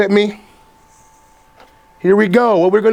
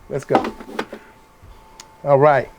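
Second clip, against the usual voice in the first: normal talking voice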